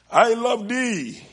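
high, slow, loud I love thee; proclaimed loud. Acted. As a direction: cry out "I love thee" with force. Repeated quote, proclaimed.

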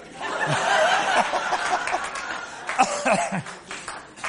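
breathy high laughter at his own joke; airy laugh. Real, not acted. Pastor laughing at his own hockey joke, airy and high. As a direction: let the laugh spill out unvoiced. After Stanley Cup joke.

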